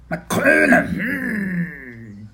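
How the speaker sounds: high, loud creature voice; delighted creature. Acted. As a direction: repeat it like an eager creature, then a long relishing hum.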